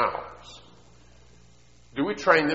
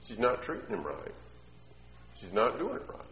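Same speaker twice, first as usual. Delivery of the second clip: normal talking voice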